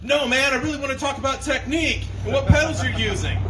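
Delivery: a gear-nerd fan pestering him; eager gear nerd. Pretend. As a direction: sound eager about pedals.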